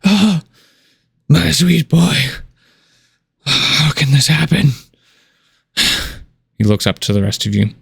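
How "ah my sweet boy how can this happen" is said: high, broken lament; old dwarf king grieving over his son; grieving father. Acted. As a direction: mourn over the body. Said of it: "rocks back and forth".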